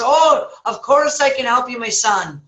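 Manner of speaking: lightly voiced priest, high at the start; Father Murphy. Priest promises help to trapped man, lightly acted priest voice. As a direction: play an obliging priest calling down. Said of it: "Father Murphy says".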